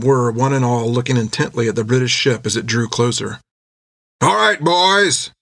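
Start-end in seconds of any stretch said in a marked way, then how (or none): 4.2-5.3 high, slow shouted call; shouting. Acted. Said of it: "shouted Jack".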